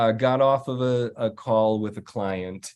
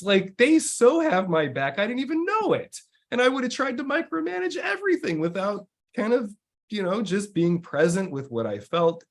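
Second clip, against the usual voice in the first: higher, moved voice of a boss near tears; touched client; 9 semitones higher than usual. Retold or imitated. Boss telling his coach the team has his back, moved and higher. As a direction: speak as if the team's care got to you, pitched up.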